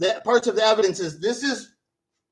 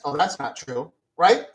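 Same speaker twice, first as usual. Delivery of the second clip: ordinary delivery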